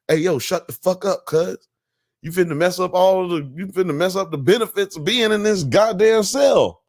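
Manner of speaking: high-pitched, indignant telling-off; mock angry scolding. Cellmates warning new people off, mock angry scolding. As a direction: act out a cellmate putting newcomers in line.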